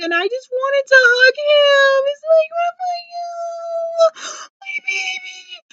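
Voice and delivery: high, tearful wail; crying for Raphael. Real, not acted. Fan wailing over Raphael, crying high. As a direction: sob out love for a hurt character. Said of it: "I was crying throughout this entire episode".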